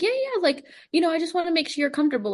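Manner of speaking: high, fast, eager coach voice; breezy high mock coach; reassuring coach. Coach reassuring her in story.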